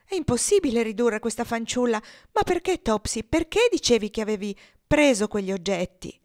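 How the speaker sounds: exasperated guardian at wits' end; despairing exasperation. Acted. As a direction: lose all hope, rising to an exasperated plea. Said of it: "con l'espressione di chi abbia perduto ogni speranza".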